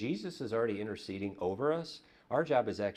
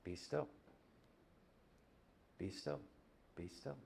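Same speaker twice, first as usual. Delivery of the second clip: each repeat softer until almost gone; fading be still; 2 semitones lower, 14 dB quieter than usual. Shown as a demonstration. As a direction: let the words sink into silence. Preacher models stillness.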